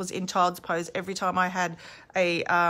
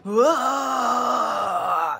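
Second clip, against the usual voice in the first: guttural primal yell; 3 semitones higher, 4 dB louder than usual. Shown as a demonstration. Teacher demonstrating the right, guttural birthing yell. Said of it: "letting out a guttural, primal scream".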